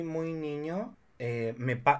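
normal talking voice

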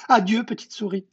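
high-pitched hasty farewell; frightened goodbye. Acted. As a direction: bid farewell as the scared snake. Frightened snake flees.

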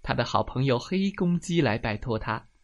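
usual speaking voice

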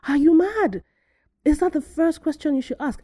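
high, loud, incredulous outrage; indignant rhetorical questions, pitched right up; furious disbelief. Retold or imitated. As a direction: re-enact being appalled by what you read. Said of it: "I was getting so furious".

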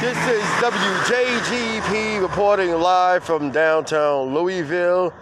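high, loud live-broadcast ident; radio announcer. Pretend. Station ident opens the show.

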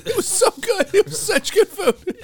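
very high, tearful falsetto; hammed-up weepy woman through laughter; crying date. Date telling friends about the dinner, mock crying in falsetto. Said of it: "She's, like, crying".

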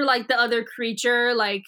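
normal talking voice

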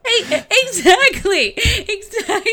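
high, loud, laughing exclamation; real laughing agreement. Real, not acted. Co-host delighted by the alien analogy, laughing. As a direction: agree while cracking up.

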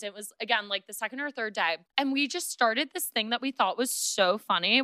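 ordinary delivery

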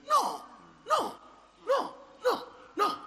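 high loud repeated no; emphatic no. Acted. Preacher rejecting throwing prayer away, loud emphatic no. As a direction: cut it off hard, no, no.

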